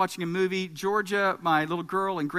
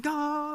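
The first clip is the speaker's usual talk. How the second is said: high, drawn-out "Goooal" at modest volume; restrained re-enacted goal celebration; long-held goal cry; 7 semitones higher, 3 dB quieter than usual. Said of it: "as long as I could hold it out".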